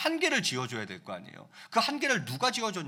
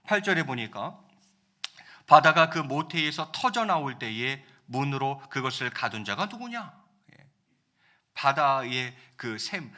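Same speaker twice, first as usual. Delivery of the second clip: ordinary talk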